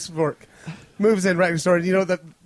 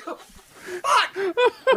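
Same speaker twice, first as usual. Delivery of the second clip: retold disbelief; 14 semitones higher, much slower than usual. Retelling shock at alley voice.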